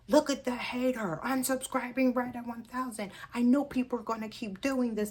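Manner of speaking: high, mocking voice of her insecure ego; petty inner voice. Voicing her jealous ego to viewers, high and mocking. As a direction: sneer like your own bitter inner critic. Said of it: "This is my crazy mind".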